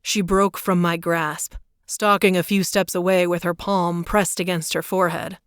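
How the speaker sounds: ordinary delivery, nothing marked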